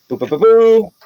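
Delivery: high run of "boo" sounds; imitated firing noises. Game master rolling the guards' shots, playful high noises. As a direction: rattle off little high sound effects. Mimics dice or arrow noises.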